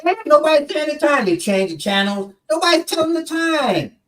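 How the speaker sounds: high-pitched, put-out listeners' gripe; mock-indignant radio listeners; exasperated complaint.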